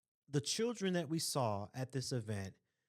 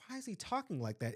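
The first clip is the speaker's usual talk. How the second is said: quick, higher-pitched retold question; puzzled reaction; 6 semitones higher, faster than usual. Speaker recalls first hearing the boy, quick and puzzled. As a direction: ask it fast, as a baffled first reaction. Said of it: "at first, I'm like".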